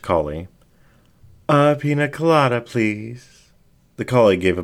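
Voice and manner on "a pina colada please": quick, higher voice for the fox; breezy little order, pitched up. Acted. Fox ordering from the collie, higher and quick.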